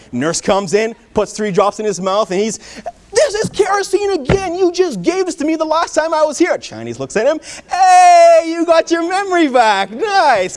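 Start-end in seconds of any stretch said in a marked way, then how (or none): 3.1-6.6 high-pitched indignant yell; hammed-up outrage at being fooled twice; mad. Lawyer to doctor after tasting kerosene once more, mad protest. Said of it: "And so he's mad". 7.7-10.6 very loud, high, drawn-out "hey"; gleeful cheer. Acted. Doctor to lawyer who just remembered the kerosene, gleeful cheer.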